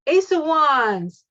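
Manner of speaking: thrilled shout of the card name; real excitement. Real, not acted. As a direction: burst out with joy at the card. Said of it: "Oh my God, I'm so excited".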